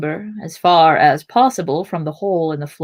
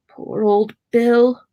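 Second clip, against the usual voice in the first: slow, higher, fond lament; lightly voiced Sam; 5 semitones higher, 3 dB louder, slower than usual. A narrator's reading. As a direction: draw it out slowly, a little high. Sam's line in narrated story.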